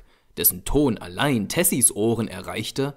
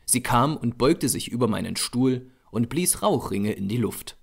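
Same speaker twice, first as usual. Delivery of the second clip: ordinary delivery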